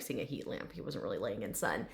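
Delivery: ordinary delivery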